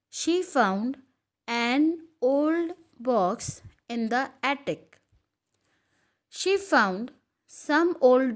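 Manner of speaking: slow, measured reading with long pauses between phrases; slow dictation reading. Real, not acted. Teacher reading a fill-in-the-blanks paragraph to students, slowly. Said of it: "I will read slowly".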